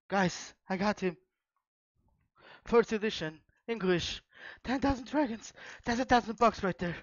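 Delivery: real excitement, nearly shouting; excited rush. Real, not acted. Announces the valuable card.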